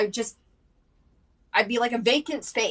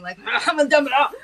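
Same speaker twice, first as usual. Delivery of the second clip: higher, louder, quick words through laughter; laughing; 6 semitones higher, 7 dB louder, faster than usual. Real, not acted. Owning up to oversharing, laughing through the words. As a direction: crack up as you say it.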